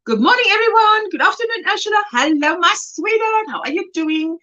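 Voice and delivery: high-pitched run of chat hellos; Raven, chirpy greetings. Retold or imitated. Reading a friend's greetings to the group, high voiced hellos. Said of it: "Raven saying".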